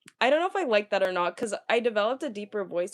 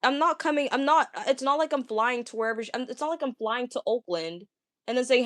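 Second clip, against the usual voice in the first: ordinary talk, nothing marked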